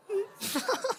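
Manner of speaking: high squeaky laugh after voice crack. Real, not acted. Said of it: "Not gonna mention that voice crack".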